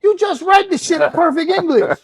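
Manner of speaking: high-pitched, full-volume comic outburst; exasperated yell. Retold or imitated. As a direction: shout it in mock outrage.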